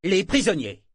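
loud, high, falling, firm repetition. A narrator's reading. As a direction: repeat it as an order. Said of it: "répéta Kenneth d'un ton ferme".

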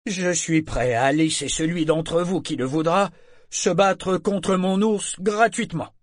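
high, projected showman's pitch; public offer. Acted. Bear trainer offers the angry crowd a free fight, projecting. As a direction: pitch your deal to a whole crowd.

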